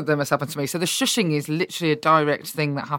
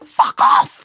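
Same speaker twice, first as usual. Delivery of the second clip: retorting fuck off; 10 dB louder than usual. Retold or imitated. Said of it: "it's just like".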